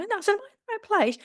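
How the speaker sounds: as if suddenly unsure you belong there; retold surprise. Old women staring in waiting room.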